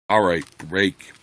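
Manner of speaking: usual speaking voice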